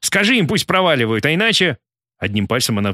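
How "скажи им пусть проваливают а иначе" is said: higher-pitched, urgent demand; restrained angry ultimatum; hostage threat. A narrator's reading. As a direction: press the threat and leave it hanging. Ким goes on threatening the hostage.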